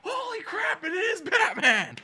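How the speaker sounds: very high, loud crowd eruption; high mock scream, stretched out at the end; amazed shout. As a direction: imitate a whole room losing it. Whole room reacts in amazement.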